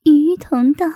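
plain reading voice

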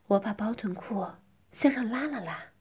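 ordinary delivery

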